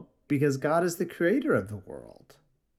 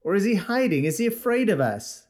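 raised, taunting questions; mocking questions; 7 semitones higher, 3 dB louder than usual. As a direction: jeer at the madman from the crowd. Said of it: "asked another".

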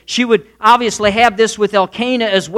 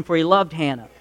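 ordinary delivery

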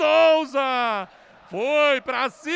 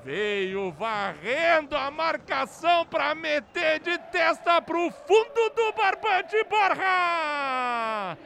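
explosive goal commentary; slower than usual. Real, not acted. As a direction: stretch every word with triumph.

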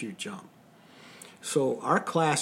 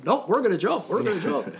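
higher, louder cheeky insistence; defiant; 6 semitones higher, 4 dB louder than usual. Retold or imitated. Students answering the angry officer, cheeky defiance. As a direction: toss it back with a grin.

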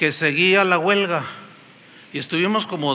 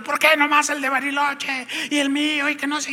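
higher, whiny protesting voice; complaining crowd; 8 semitones higher than usual. Retold or imitated. Stranded passengers protesting at the airline counter, whiny.

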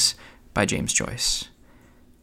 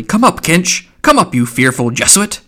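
loud, raised summoning shout; coarse call; 6 semitones higher, 5 dB louder than usual. A narrator's reading. Mulligan calls down the dark stairs to his friend, coarse shout. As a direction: call out coarsely to someone below. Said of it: "called out coarsely".

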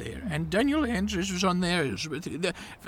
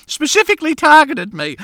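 indignant rant; 3 semitones higher, 8 dB louder than usual. Acted. Aggrieved rant about the premier.

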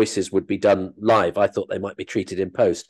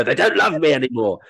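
mock despair; 3 semitones higher than usual. Acts insecure actor's dejection.

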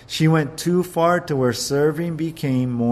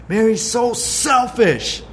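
pitched-up petulant grumble; mock indignant accusation; 7 semitones higher, 4 dB louder than usual. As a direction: accuse her sister in a huff. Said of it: "Martha's saying".

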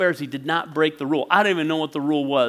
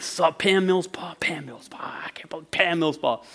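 mock muttering, fading to a whisper; imitated grumbling; 7 dB quieter than usual. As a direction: grumble the name under your breath as you pass. Brother grumbling past his imagined shrine.

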